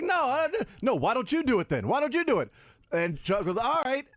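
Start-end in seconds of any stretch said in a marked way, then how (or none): 0.0-2.5 high-pitched, worked-up comic protest; retold indignant challenge. Retold studio quarrel, throwing down challenge. 3.6-4.1 high-pitched, halting mock read-out; imitated stumbling reading. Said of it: "and he just starts stuttering".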